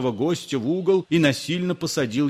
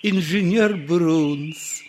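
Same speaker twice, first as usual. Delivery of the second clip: higher, slow, gentle question; pleasant voice; 8 semitones higher, slower than usual. A narrator's reading. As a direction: ask it kindly. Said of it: "спросил приятным голосом".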